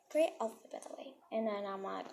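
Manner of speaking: normal talking voice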